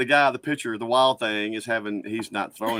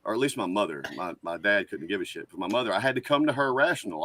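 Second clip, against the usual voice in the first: ordinary delivery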